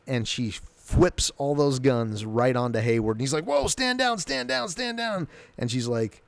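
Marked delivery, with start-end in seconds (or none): 3.4-5.3 high-pitched, frantic retold plea; acted panic. Hayward begging as the guns turn on him, acted panic. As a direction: rattle it off in a mock panic, pitch shot way up.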